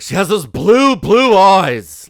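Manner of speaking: sneering imitation of her irritation; irritated mock voice. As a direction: spit out blue eyes with disgust. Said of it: "she was like".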